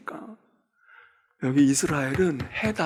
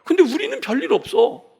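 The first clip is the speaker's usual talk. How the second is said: ordinary talk, nothing marked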